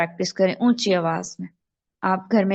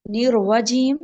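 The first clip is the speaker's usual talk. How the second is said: ordinary talk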